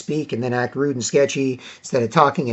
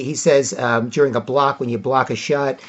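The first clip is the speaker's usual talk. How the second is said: usual speaking voice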